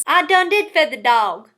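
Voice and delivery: drawled Southern twang; Southern accent. Shown as a demonstration. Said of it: "the Southern way of speaking".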